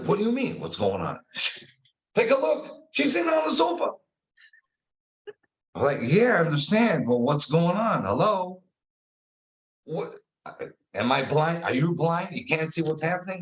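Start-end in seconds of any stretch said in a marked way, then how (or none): 0.0-3.9 high, insistent inner voice; inner voice argument. Retold or imitated. Talking to himself in psychodrama, pointing it out insistently. 6.0-13.4 exasperated. Retold or imitated. Arguing with his inner voice, exasperated and incredulous.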